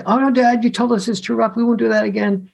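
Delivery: put-on high, pleading child's voice; a kid begging dad not to stop; whiny kid protest. Sons begging dad to keep roughhousing, high and pleading.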